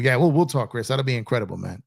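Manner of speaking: usual speaking voice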